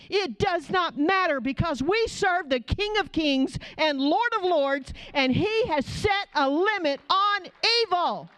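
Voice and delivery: high, ringing preacher's call; fiery preaching, pitch soaring; preaching at full force. Acted. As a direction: lift them up with a fiery declaration.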